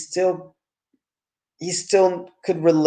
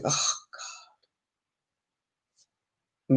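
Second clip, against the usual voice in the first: eye-roll groan; 10 dB quieter than usual. Real, not acted. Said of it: "Mega eye roll".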